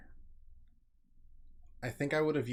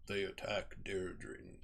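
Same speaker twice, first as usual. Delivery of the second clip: low, slow, soft narrator imitation; strained-for depth, drawn out slowly; attempted deep narrator; 5 semitones lower, 9 dB quieter, much slower than usual. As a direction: try for a deep game-narrator voice. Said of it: "I can't even get that deep".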